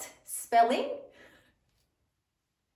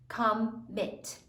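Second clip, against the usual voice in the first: "Commit" split into com, mit; syllable by syllable; 3 semitones lower, 5 dB quieter than usual. Shown as a demonstration. Teacher finishes sounding out, "Commit" syllable by syllable. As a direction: blend "Commit" in two careful pieces. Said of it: "Sound it out".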